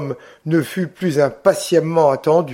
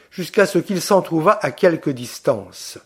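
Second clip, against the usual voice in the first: ordinary delivery, nothing marked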